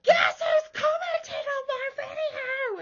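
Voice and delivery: high, loud thrilled shouting; shrieking with excitement to share news; excited scream. Retold or imitated. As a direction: yell it to your husband in delight. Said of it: "I literally screamed".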